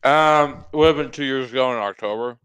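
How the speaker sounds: talking with mouth full; words round a bite of food; chewing while talking. Real, not acted. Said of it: "can you guys hear me eating?".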